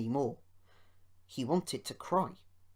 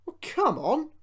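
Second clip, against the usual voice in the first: much higher, louder coaxing; urging; 13 semitones higher, 9 dB louder than usual. A narrator's reading. Mister Hugh urging Rusty to start, pleading. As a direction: push him to start. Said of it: "he urged".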